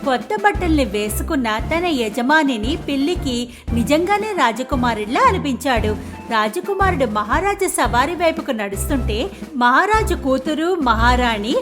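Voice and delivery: ordinary delivery, nothing marked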